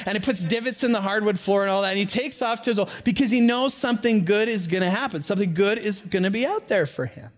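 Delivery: ordinary talk, nothing marked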